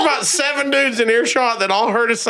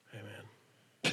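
hushed, voiceless acknowledgement; retold whispered greeting; 12 semitones lower, 22 dB quieter than usual. Man greeting someone in the elevator, whispered. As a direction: whisper it under your breath.

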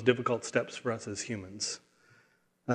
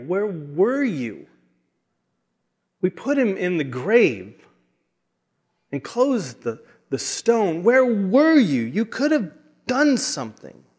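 high, pleading cries of grief, building louder; anguished heart cry; 6 semitones higher, 4 dB louder than usual. Acted. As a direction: cry out in wounded reproach, drawing out the question. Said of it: "almost hear the heart cries of".

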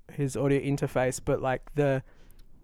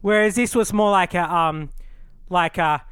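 usual speaking voice

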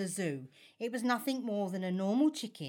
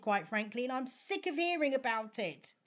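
normal talking voice

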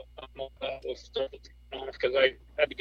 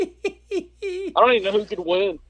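laughing; 4 semitones higher, 4 dB louder than usual. Real, not acted. Still laughing.

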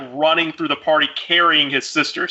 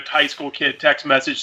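ordinary talk

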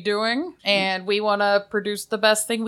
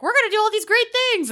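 mocking Kickstarter pitch; 5 dB louder than usual.

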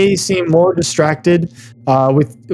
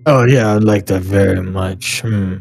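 lightly voiced low bear; a hint of a bear voice, dropping low; agreeable polar bear; 6 semitones lower than usual. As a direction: answer low and easy, like a big creature. Polar bear answers the player.

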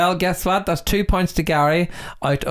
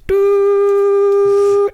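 long, loud, high held beep; imitated dial tone; 6 dB louder than usual. Host mimics line after caller hung up, dial tone. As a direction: hold a high doo like a dead line. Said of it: "She hung up".

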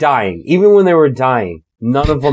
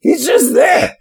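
excited high shout; 13 semitones higher, slower than usual. Real, not acted. Friends laughing, shouting.

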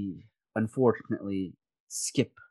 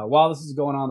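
ordinary talk, nothing marked

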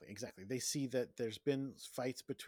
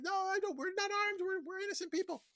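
much higher, frantic little voice; squeaky mock plea. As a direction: squeak it out like terrified hostages. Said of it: "And it's like".

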